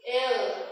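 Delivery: "L" said the English way, as "el"; English letter name. Shown as a demonstration. Teacher showing pupils the English letter, "L" as "el". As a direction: pronounce "L" as one-syllable English "el". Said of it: "la pronunciación de la L es L".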